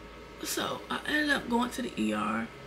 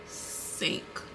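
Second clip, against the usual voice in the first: heavy whisper; 8 dB quieter than usual. Real, not acted. Hearing she nearly went blind, a whispered sink. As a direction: sink let out as a voiceless whisper. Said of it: "That made my heart".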